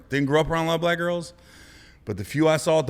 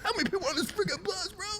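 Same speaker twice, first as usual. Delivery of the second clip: high, fast astonished outburst, laughing; amazed; 6 dB quieter, faster than usual. Retold or imitated. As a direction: gasp out how many keep coming. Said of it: "I was like".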